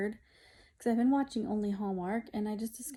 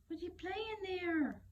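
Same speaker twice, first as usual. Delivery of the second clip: pet talk; 9 semitones higher, 6 dB quieter than usual. Real, not acted. Cooing at the cat.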